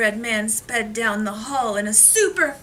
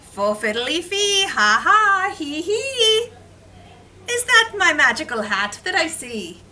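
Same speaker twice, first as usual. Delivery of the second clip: giggling leprechaun; 6 semitones higher, 4 dB louder than usual. Acted. I heard a small giggle.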